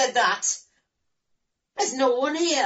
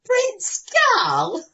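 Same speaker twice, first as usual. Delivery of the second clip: high disbelieving laugh; mocking laugh; 12 semitones higher than usual. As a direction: laugh at the claim. Fairy laughs at prince claim.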